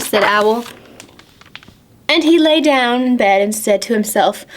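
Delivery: ordinary delivery, nothing marked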